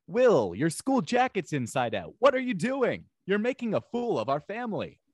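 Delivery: high-pitched scolding with swooping tune; Carlton Banks impression. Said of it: "Carlton Banks impersonations?".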